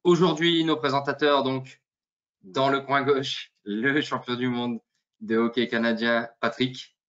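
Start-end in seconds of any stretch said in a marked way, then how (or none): 2.4-7.0 slow, drawn-out ring-announcer intro, played for laughs; mock ring announcer. Webinar host jokingly introducing the first presenter, slow mock ring announcer. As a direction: announce him like a boxer entering the ring.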